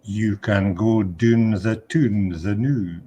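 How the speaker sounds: put-on slow, deadpan broad Scottish accent; broad Scots punchline. German guard waving the lads on, slow deadpan broad Scots. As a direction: land it slowly in thick Scots.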